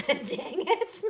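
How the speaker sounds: high laughing dang it; real amused groan through laughter. Real, not acted. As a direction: laugh it out, half annoyed. Piece pops up, she laughs.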